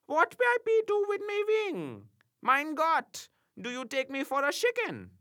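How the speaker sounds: put-on high, loud indignant German accent; outraged German imp. A narrator's reading. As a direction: fume at the insult. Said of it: "highly incensed".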